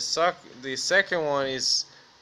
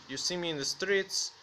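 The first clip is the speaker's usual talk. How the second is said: ordinary talk, nothing marked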